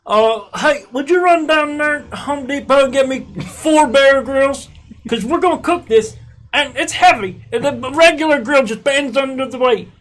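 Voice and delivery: high, loud, hammed-up English accent; mock English accent. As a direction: put on a shrieky, over-the-top English voice. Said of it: "That's what people in England talk like".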